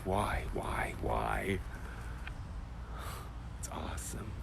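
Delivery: whispered in wonder; awed whisper. Real, not acted. Teacher to class on the angels' stillness, in an awed whisper. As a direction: let the awe hush you.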